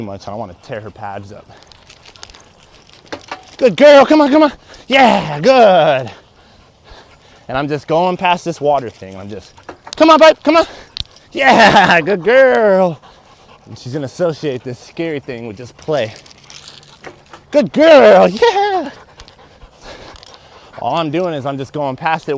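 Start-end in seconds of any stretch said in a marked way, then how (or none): 3.6-6.1 excited dog praise. Real, not acted. 10.0-13.0 excited dog praise. Real, not acted. 17.5-18.9 excited dog praise. Real, not acted.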